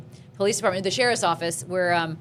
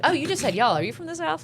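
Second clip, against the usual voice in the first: curious Angeleno; 8 semitones higher than usual. Retold or imitated. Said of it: "people will be like".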